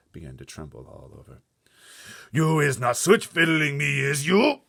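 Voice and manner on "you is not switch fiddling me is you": booming, much higher and louder giant voice; bloodbottler giant. Acted. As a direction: bellow it as a suspicious brute giant. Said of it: "said the blood bottler".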